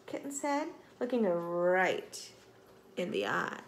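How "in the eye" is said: plain reading voice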